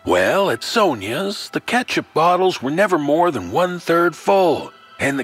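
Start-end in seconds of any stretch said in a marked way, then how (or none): none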